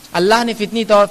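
normal talking voice

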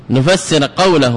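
normal talking voice

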